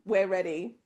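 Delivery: normal talking voice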